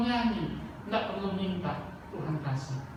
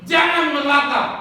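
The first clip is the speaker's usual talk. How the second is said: loud, high command to the congregation; emphatic preaching shout; 6 semitones higher, 11 dB louder than usual. Real, not acted. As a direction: shout it as a firm order. (seruan lantang dan tegas)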